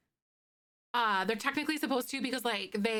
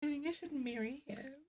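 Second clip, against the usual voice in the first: soft, touched; 3 semitones higher, 8 dB quieter than usual. Real, not acted. Touched by boyfriend's gift.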